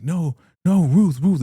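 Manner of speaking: high, loud mock pleading; imitating crying out. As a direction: wail her name in panic. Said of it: "He's crying for Ruth".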